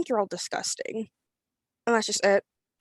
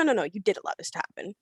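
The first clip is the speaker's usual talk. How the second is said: ordinary talk, nothing marked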